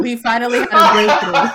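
loud laughing line. Real, not acted.

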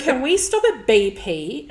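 put-on kid voice. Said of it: "quoting daughter in car".

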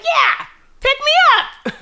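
put-on very high, slow little girl voice; high child voice. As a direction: beg like a small child. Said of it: "she's like".

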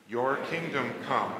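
ordinary delivery

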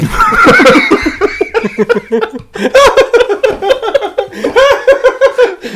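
real helpless high cackle. Real, not acted. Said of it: "That was like the most old man, like, little old lady laugh".

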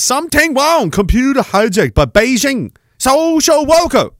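high, exaggerated fake Chinese accent; mock Chinese accent. Streamer reading Simon's typed joke aloud, mock Chinese accent. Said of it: "exactly how to do the accent".

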